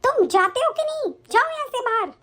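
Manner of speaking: high, exasperated order; angry dismissal. Acted. Wife ordering husband out on his birthday, angry and impatient. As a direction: drive him out of the room.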